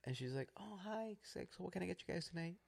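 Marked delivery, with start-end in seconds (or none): 0.6-1.2 put-on higher, softer young drive-thru girl. Young worker greeting customers at the drive-thru, high soft girl voice. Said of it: "this little Mexican girl, young girl". 1.5-2.6 high, soft, quick order-taker; young girl. Retold or imitated. Young worker taking a drive-thru order, quick high girl voice. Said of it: "A young girl comes up to us".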